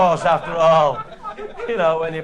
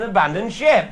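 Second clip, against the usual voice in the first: retold shout; 2 semitones higher, 7 dB louder than usual. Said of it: "I was running after him shouting".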